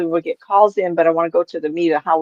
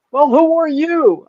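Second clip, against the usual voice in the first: high, slow, hammed-up challenge; drawn-out imitation of an imposing woman; robust woman; 8 semitones higher, 4 dB louder, slower than usual. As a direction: play a big woman sizing up a stranger. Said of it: "this robust woman".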